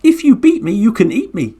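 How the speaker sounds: high cheeky turtle voice; little turtle's dare. Acted. Turtle repeating its dare to the Garuda bird, high and cheeky. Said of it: "said the turtle".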